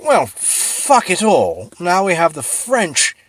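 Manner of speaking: high, loud, slow put-on Englishman's voice; exasperated British. British complaining about the French blockade, put-on exasperated voice. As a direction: huff like a fed-up redcoat. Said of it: "the British were like".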